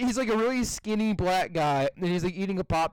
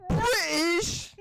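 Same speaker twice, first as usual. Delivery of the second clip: squeaky rising mock astonishment; high-pitched meme impression; 9 semitones higher than usual. Retelling the British guy meme, high-pitched imitation. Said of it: "so high pitched at the end".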